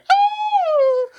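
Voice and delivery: a sudden very high, loud "Ooh!"; mock moan. Voicing a dick's reaction to the hosts, very high squeal. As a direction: squeal it like a startled cartoon. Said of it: "your dick be like".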